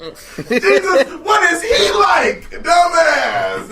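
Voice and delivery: loud, high, drawn-out mock outburst; exasperated shout. Host riffs on being told he could be Christ, exasperated mock shout.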